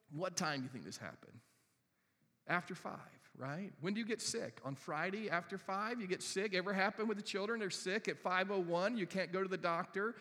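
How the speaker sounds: ordinary talk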